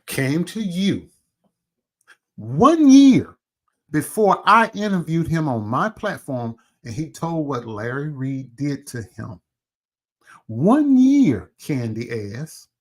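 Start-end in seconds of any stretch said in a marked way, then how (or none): 2.4-3.4 "one year" shouted much higher and louder; stressed. Real, not acted. Stressing time in rant. 10.5-11.5 "One year" shouted high and loud; stressed. Real, not acted. Repeats point angrily.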